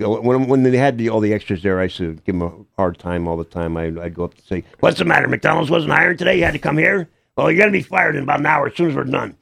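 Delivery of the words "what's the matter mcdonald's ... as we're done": put-on higher, teasing old-man character voice; Seymour Lustig. Actor in character teasing film extras, higher cranky old-man voice. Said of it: "stay in character".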